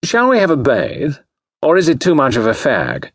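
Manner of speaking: high, languid dandy voice; affected high-pitched languor. A narrator's reading. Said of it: "The immaculate Claude murmured languidly".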